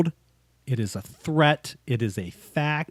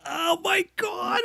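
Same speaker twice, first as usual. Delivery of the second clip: excited cry; 4 dB quieter, slower than usual. Real, not acted. Reacting to the quote.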